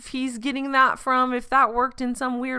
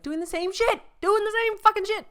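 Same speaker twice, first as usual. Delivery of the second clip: indignant building; 11 semitones higher than usual. Real, not acted. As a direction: build the outrage on the repeat. Indignant repeat, climbing.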